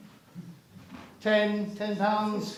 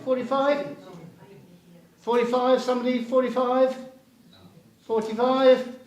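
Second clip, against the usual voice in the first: pushy auctioneer, pitch up, urging bids; 3 semitones higher, 3 dB louder than usual. Real, not acted. Auction bidding calls.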